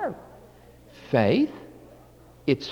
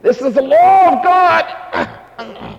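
slow, louder, higher pompous declaration; hammed-up jailer reading a decree; mock stern official; 8 semitones higher, 6 dB louder, slower than usual. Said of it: "they strap you up and say".

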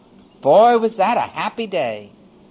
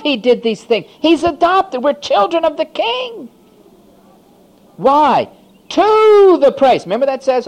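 high, loud jubilant preaching; loud, high exultant sermon; full-force preaching; 10 semitones higher, 6 dB louder than usual. Acted. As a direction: lift the whole room with it.

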